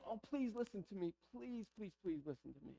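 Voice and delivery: hushed, repeated pleading; begging. Retold or imitated. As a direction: beg softly, fading almost to nothing. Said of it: "it would be this place of begging".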